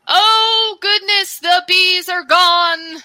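high-pitched, drawn-out relief; relieved rescued character. Acted.

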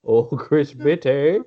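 slow, silly put-on voice, rising; teasing wordplay. Co-host making up rude nicknames for Chris Whitty, teasing. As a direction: draw out the silly name with a lift. Playful mangling of a name.

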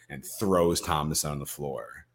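ordinary talk

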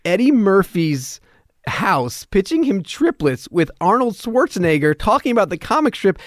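mock-dazed inner monologue; awestruck disbelief. Marvelling at his absurd situation.

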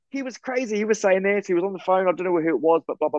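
starts very high and excited, settling down; eager retelling. Retold or imitated. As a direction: blurt it out to security, calming as you go. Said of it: "I'm saying".

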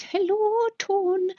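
a high, bright Irish lilt on the greeting, lightly imitated; imitating Irish grandmother. Grandmother greeting her grandchild, imitated Irish accent. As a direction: greet like an Irish nanny would, in fond imitation. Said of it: "Nanny Walsh was always".

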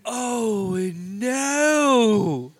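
high, loud cry of dismay; shocked cry. Real, not acted. Reacting to brutal scene.

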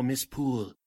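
usual speaking voice

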